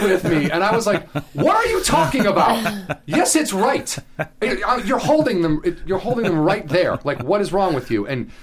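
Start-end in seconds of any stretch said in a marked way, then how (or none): 1.3-8.1 incredulous mock outburst; exasperated retelling. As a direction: rant at her like she's crazy. Arguing with customer, retold.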